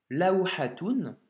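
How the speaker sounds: laouhatoun slowly and clearly; slow demo reading. Shown as a demonstration. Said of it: "que l'on vient de voir". (laouhatoun lu lentement)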